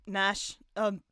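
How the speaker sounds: ordinary talk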